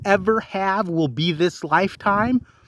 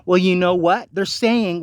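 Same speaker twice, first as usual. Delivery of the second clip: ordinary talk, nothing marked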